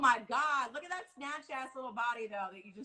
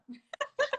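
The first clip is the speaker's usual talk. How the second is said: high laugh. Real, not acted. Host laughs at door scare.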